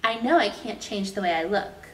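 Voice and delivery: plain reading voice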